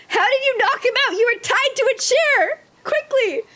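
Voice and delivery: high-pitched, urgent character voice; alarmed accusation. Acted. Acts out characters' heated confrontation.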